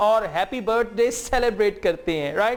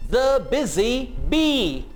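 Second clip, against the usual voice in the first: Become the busy bee drawn out very slowly; slow teacher demo; slow model sentence; much slower than usual. Shown as a demonstration. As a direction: stretch it for learners. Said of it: "इन sentences को मेरे साथ-साथ repeat करें".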